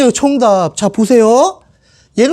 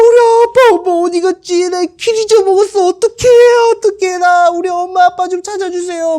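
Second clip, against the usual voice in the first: put-on much higher, childlike pleading; a frightened kid begging for help; lost little child; 9 semitones higher than usual. Lost boy Jesus asks strangers to find his parents, whimpering.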